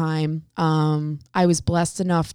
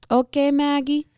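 imitating the boss again; 7 semitones higher than usual. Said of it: "And he was like".

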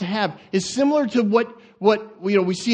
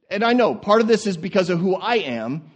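ordinary talk

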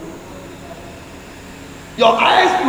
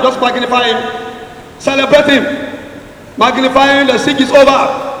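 ordinary delivery

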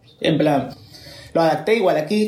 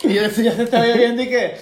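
laughing; 7 semitones higher than usual. Real, not acted. Laughing at the awkward overlap.

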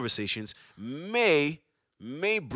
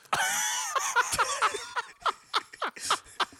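bursting out in high laughter; a long, high real laugh; big high laugh. Real, not acted. Amused by the podcast DM.

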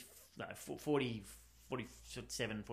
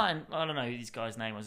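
usual speaking voice

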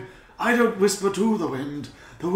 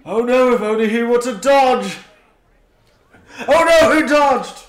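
mock dismay; 4 semitones higher, 7 dB louder than usual.

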